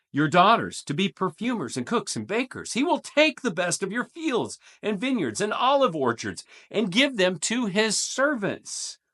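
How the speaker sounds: measured, dramatic Bible reading; solemn scripture read to the congregation. Acted. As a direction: read the passage like a proclamation. Reads scripture passage aloud.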